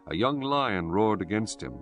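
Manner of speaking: usual speaking voice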